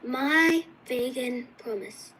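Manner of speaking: words drawn out one by one; slow teacher-style reading. Shown as a demonstration. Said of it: "So you read it like this".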